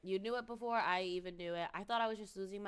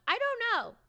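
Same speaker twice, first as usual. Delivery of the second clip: much higher, louder squeal; squeaky shrug; 13 dB louder, faster than usual. Real, not acted. Streamer answering a chat question, squeaky.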